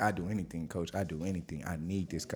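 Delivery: low, soft imitation of pleading; understated, hushed begging of a coach; quiet retold plea. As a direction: retell the plea softly, voice dropped low. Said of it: "that scene always make me fucking tear up".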